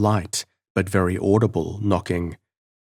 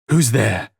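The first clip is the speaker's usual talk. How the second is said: forced brave call into the dark; 3 semitones higher, 4 dB louder than usual. A narrator's reading. Frightened prisoner calling into the dark, forced courage. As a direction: push the question out louder. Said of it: "with a vehement effort I gained courage to call out".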